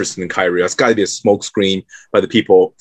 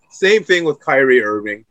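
normal talking voice

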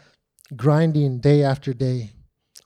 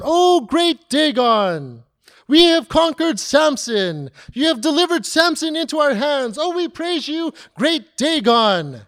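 pagan worshippers; 10 semitones higher than usual. Acted. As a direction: cry out to the idol with exaggerated reverence. Pagan crowd worshipping Dagon.

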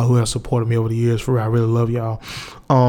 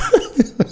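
sudden high laugh breaking out; high burst of laughter; 13 semitones higher than usual. Real, not acted. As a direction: crack up at your own words. Laughs at own confession.

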